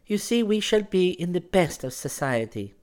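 put-on high-pitched, tentative girl's voice; timid. A narrator's reading. Girl jokes about the statues, venturing timidly. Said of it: "she ventured timidly".

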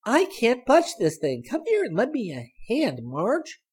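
straining. A narrator's reading. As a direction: heave as you ask for help. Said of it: "Sally grunted as she pulled".

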